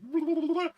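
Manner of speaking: high burbling mock-gore noise; imitated dangling noise. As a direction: trill a silly high wobble. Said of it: "it's just hanging out like".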